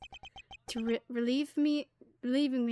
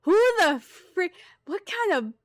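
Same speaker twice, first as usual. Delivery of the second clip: drawn-out louder cry of disbelief, pitched up; startled outburst; 5 semitones higher, 5 dB louder than usual. Real, not acted. Streamer sees strange new character, startled loud cry. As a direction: blurt it out in shock.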